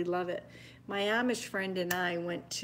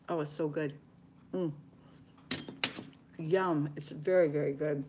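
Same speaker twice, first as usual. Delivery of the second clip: talking around a bite; mouth full; 2 semitones lower than usual. Real, not acted. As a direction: savor it with food in your mouth. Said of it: "Don't wanna talk with my mouth full".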